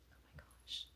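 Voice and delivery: hushed, unvoiced awe; breathless whisper. Real, not acted. Whispering in awe at the shirt she unboxed. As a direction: breathe it out, stunned.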